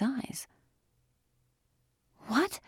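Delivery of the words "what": startled, high-pitched question; startled sputter. A narrator's reading. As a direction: blurt it out in shock, pitch leaping up. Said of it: "Andy sputtered and flapped backward".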